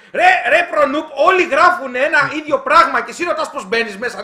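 loud, high, laughing in disbelief; real laughing outburst at a viewer; exasperated laughing call-out. Real, not acted. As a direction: call him out through a laugh. Viewer keeps asking how to join.

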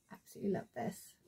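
breathy, delighted whisper; soft whispered delight. Real, not acted. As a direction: hush it out like a treasure. Delighted over a baby grow.

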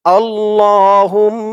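আল্লাহুম্মা drawn out slowly with a doubled l and m; slow recited Arabic. Shown as a demonstration. Teacher demonstrating the word for viewers, slow chant. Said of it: "বলুন আমার সাথে".

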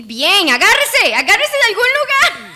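excited yell rising high and loud; urgent shouting. Real, not acted. Player telling Ricky to grab on somewhere, urgent shout.

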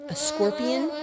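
ordinary delivery, nothing marked

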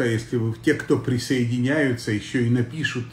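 ordinary talk, nothing marked

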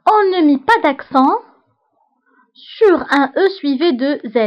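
slow, deliberate dictation of a rule; dictating to a class. Shown as a demonstration. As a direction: read it out slowly so students can write. Teacher dictates French rule.

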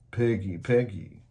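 complaining. A narrator's reading. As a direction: sulk like a let-down monster. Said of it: "Herbert complained".

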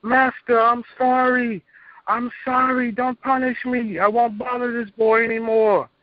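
high, slow begging wail of a demon; pleading demon. Retold or imitated. Demon begging its Master not to punish it, high pleading wail. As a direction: beg your master for mercy, way up high. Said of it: "these demons start hollering".

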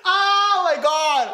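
very drawn-out, loud, high exclamation; long, high wail of embarrassment; mortified outcry. Real, not acted. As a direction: cry out in cringing disbelief. Cringing at the singing.

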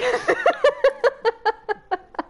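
shrieking laughter; high squealing laugh. Real, not acted. Laughing in panic.

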